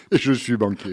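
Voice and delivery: laughing confession, voice jumping up; laughing loudly. Real, not acted. Guest laughs at own answer.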